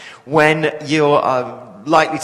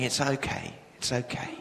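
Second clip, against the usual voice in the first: hushed, much softer comforting words; soothing; 4 semitones lower, 12 dB quieter than usual. Retold or imitated. Stranger hugging the crying man, soft soothing reassurance. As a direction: murmur it gently like holding a sobbing man. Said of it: "and saying".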